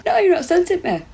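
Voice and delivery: said through a yawn. Real, not acted. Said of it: "I'm yawning now as well".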